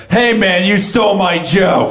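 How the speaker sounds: high-pitched heckler's yell; imitated yell. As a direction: call out in protest like an aggrieved heckler. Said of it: "another guy stood up and yelled".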